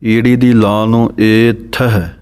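ordinary talk, nothing marked

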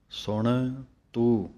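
ਸੁਣ and ਤੂੰ spoken slowly as two distinct words; words kept separate. Shown as a demonstration. Teacher showing that ਸੁਣ ਤੂੰ must not run together, slowly. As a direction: keep a small break between ਸੁਣ and ਤੂੰ. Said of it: "ਅਲੱਗ-ਅਲੱਗ ਬੋਲਣਾ ਹੈ".

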